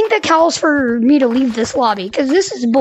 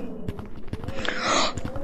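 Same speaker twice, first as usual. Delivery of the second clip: out-of-breath gasp; 11 dB quieter than usual. Real, not acted. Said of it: "so I'm a little out of breath".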